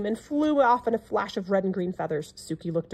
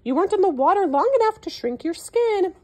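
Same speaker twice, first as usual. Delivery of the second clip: higher, louder coaxing call; calling after; 10 semitones higher, 6 dB louder than usual. A narrator's reading. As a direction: call the elephant back to the water. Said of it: "called the hungry crocodile".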